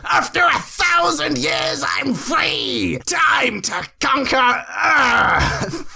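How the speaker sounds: high, slow, triumphant villain roar; villain shout. Acted. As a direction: bellow it like a freed demon. Villain proclaims freedom.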